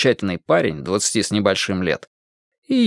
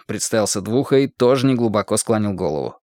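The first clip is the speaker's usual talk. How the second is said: ordinary delivery, nothing marked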